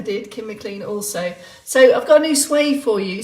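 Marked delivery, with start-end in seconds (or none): none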